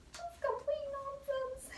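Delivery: high, slow mock-scolding voice; a silly high voice scolding herself; silly squeaky voice. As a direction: squeak it like a cartoon critic. Mocking her own nonsense.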